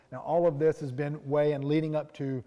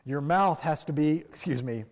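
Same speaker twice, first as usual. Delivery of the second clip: normal talking voice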